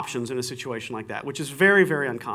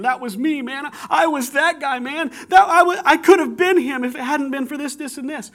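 higher, louder pleading cry, lightly voiced; a touch of tearful sobbing, retold; imitating sobbing friend; 11 semitones higher, 6 dB louder than usual. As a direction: friend on the subway, voiced as he weeps. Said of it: "he's still sobbing".

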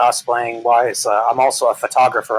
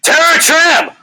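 shouting; 6 dB louder, slower than usual. Real, not acted. Calls out crew's nightly tradition.

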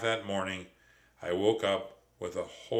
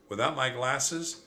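ordinary delivery

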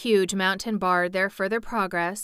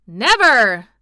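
very loud, emphatic, falling exclamation; indignant cry; 3 semitones higher, 12 dB louder than usual. A narrator's reading. Officers echoing their general, loud indignant cry. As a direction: protest with full indignant force. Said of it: "cried all the other officers".

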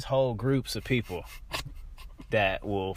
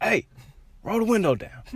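angry; 11 semitones higher, 6 dB louder than usual. Retold or imitated. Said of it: "I'm mugging".

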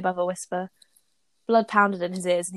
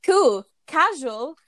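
higher, slow, airy mock-casual; mock breezy Hermione; 7 semitones higher, 5 dB louder, much slower than usual. Friend mocking Hermione's breezy brush-off, slow and high. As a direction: drag it out like she's shrugging it off. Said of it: "she always goes".

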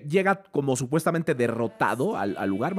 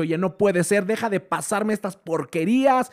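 the wolf finally losing patience; fed-up wolf; 6 semitones higher than usual. Retold or imitated. The wolf judge fed up with the auditions, exasperated. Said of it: "se levanta y dice".

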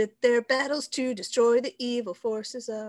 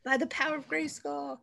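lightly voiced hero; 6 semitones higher, 7 dB quieter than usual. Quoting the He-Man cartoon again, lightly voiced proclamation. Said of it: "He-Man".

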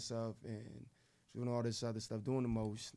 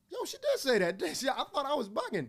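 much higher, louder mock excited gossip; excited bystander; 11 semitones higher, 6 dB louder than usual. Woman next in line asking if she heard right, excited and high. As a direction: play the stunned neighbor in line, high and loud.

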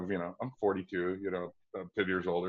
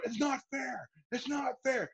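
high whiny echo; 5 dB quieter than usual. Retold or imitated. Retelling himself in the room.

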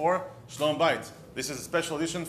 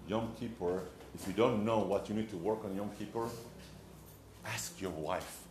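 hushed punchline; 6 semitones lower, 8 dB quieter than usual. Real, not acted. Rabbi quietly telling husbands to ask their wives. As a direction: lean in and drop the punchline.

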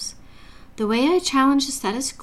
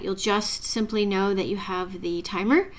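usual speaking voice

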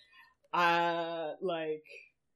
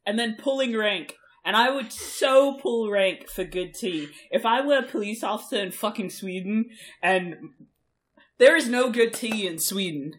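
loud emphatic rant; 7 dB louder than usual. Real, not acted. Excited rant about good tea.

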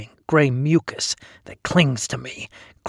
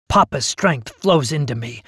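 ordinary delivery, nothing marked

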